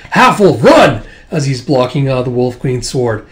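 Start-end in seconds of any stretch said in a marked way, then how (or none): 0.1-1.0 loud, higher warning; urgent shout. Acted. Corbin shielding the Half-Wolf, urgent shout. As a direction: shout at her to flee.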